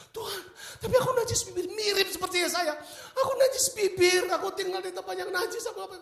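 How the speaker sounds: very high, loud, laughing protest; high panicked mock plea; trembling plea. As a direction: cower and protest you are unworthy. Pleading before God, Isaiah.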